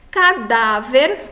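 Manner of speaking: slow "Cadáver" with a strong stressed DÁ; penultimate stress. Shown as a demonstration. Teacher showing the tonic syllable, slow "Cadáver" with middle stress. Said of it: "a penúltima sílaba mais forte".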